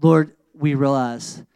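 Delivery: slow, earnest prayer with long pauses; closing prayer. Acted. Pastor praying over the congregation, slow and earnest. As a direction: pray it slowly and earnestly over the people.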